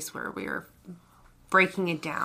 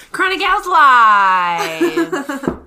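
announcer; 6 semitones higher, 13 dB louder, much slower than usual. Acted. Calling out the show name.

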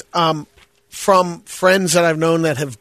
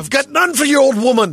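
mock Scottish retort; 9 semitones higher than usual. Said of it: "rehearsing the line on beggars".

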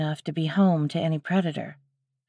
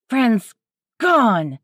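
higher, louder furious cry; angry shout; 5 semitones higher, 6 dB louder than usual. A narrator's reading. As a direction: spit it out in rage. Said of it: "she shouted angrily".

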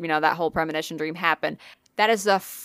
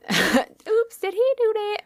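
very high, playful put-on voice; mock innocent; slower than usual. Host mocking Zach leaving, high mock innocence. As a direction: feign innocence in a squeaky high pitch.

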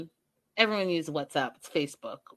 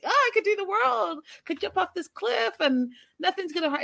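high, breezy youthful boast; carefree bravado; 10 semitones higher than usual. Acted. Recalling being twenty, high-pitched fearless excitement.

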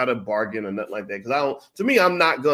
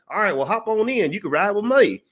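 put-on higher, friendly old Kentucky man; lightly voiced old man; 9 semitones higher, 4 dB louder than usual. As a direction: play the kind old cart driver, pitched up.

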